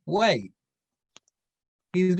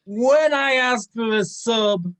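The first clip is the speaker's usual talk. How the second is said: annoyed rant; 5 semitones higher, 5 dB louder than usual. Acted. Balthazar scolding his patron, loud and annoyed. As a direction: chew out the patron.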